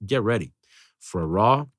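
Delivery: normal talking voice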